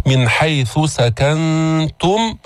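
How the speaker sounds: slow chant, ث kept apart from س in "حيث سكنتم"; separated consonants. Shown as a demonstration. Teacher drills separating the letters, slow chanted articulation of "حيث سكنتم". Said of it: "افصل الثاء عن السين".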